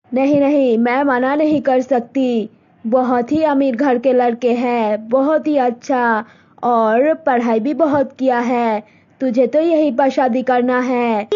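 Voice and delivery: mother telling daughter about a proposal; Malti's mother. Acted. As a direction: speak as a set-in-her-ways village mother. Mother insists on marriage.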